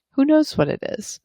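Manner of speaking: ordinary talk, nothing marked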